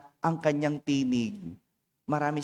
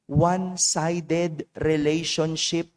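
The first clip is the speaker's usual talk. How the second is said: slow emphasis; much slower than usual. Real, not acted. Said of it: "slowed key term".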